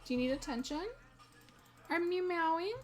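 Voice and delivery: high sweet pet talk. Real, not acted. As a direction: coo at the meowing cat. Cooing at her cat.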